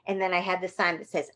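ordinary talk, nothing marked

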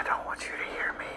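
breathy secret whisper. Pretend. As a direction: whisper so nobody hears. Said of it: "why are we whispering?".

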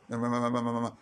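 low monotone mumbo-jumbo chant; imitating rote, droning prayer; mock prayer babble. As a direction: rattle off nonsense syllables on one low note. Mimics faster's rote prayer babble.

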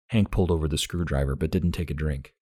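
usual speaking voice

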